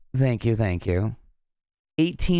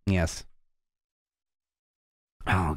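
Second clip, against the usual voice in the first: ordinary talk, nothing marked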